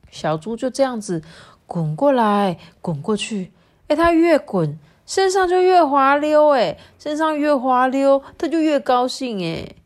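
animated picture-book reading; excited storytelling to children. A narrator's reading. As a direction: tell it with mounting glee.

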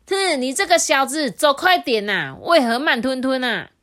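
high, loud, bossy bunny cracking the whip; bossy rabbit rider. A narrator's reading. Rabbit on deer father's back whipping him, high bossy shout. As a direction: order the deer around from his back. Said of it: "还大声说".

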